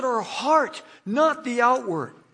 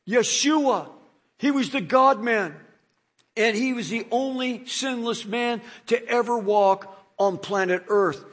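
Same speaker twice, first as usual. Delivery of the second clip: normal talking voice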